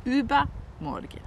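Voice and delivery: the first part of übermorgen pitched high and stressed; stress on ÜBER. Shown as a demonstration. Teacher correcting morgen to the day after, stressed on über in übermorgen. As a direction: hit the über in übermorgen, then drop on morgen. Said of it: "nicht morgen".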